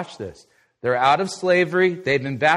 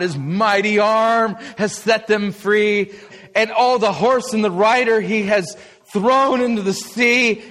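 jubilant; 5 semitones higher, 4 dB louder than usual. Retold or imitated. Retelling Israel's praise song.

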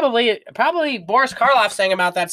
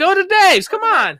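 excited ad hype; 7 dB louder than usual. Acted. Hyped fake ad push.

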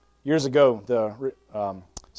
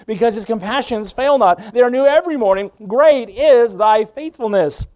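louder, higher revival preaching; preaching at full force; 8 semitones higher, 8 dB louder than usual. Acted. Preacher urging the church toward revival, loud and fervent. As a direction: thunder hope over the congregation.

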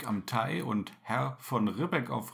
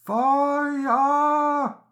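high, loud cry of alarm; fire alarm scream; 12 semitones higher, 6 dB louder than usual. Acted. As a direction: scream it out in panic. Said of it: "wie ein Schrei".

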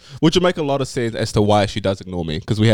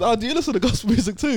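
high friendly voice; 10 semitones higher than usual. Acted. Neighbour's reply, high voice.

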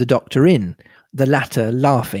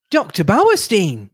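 high-pitched cry of surprise; exclaiming; 7 semitones higher than usual. A narrator's reading. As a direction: exclaim it high, falling at the end. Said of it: "exclaimed Cynthia".

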